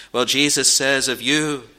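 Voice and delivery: ordinary talk, nothing marked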